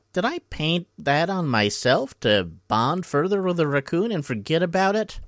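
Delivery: high, singsong bafflement; puzzled self-questioning. Retold or imitated. Jeb at the mirror spots a mask, high puzzled voice. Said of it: "He's like".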